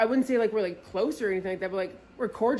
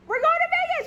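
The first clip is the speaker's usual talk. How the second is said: much higher and louder yell; excited shout; 7 dB louder, much faster than usual. Retold or imitated. To her friend after booking, excited shout. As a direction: shout it out in pure joy.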